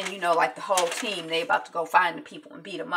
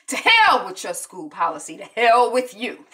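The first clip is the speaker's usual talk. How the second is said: furious father; 3 semitones higher, 8 dB louder than usual. Retold or imitated.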